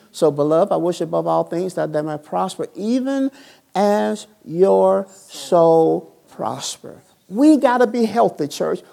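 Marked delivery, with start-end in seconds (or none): 2.7-7.0 drawn out. Real, not acted. Drawn-out preaching emphasis.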